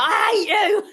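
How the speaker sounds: high mock yell breaking into a laugh; half-laughing outburst at her mother; retold teenage shout at mum. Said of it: "I remember shouting at my mum".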